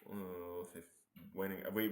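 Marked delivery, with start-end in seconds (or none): none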